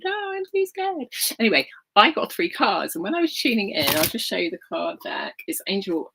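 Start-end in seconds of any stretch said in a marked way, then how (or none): 0.0-1.1 scared inner voice. Retold or imitated. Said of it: "part of me is like".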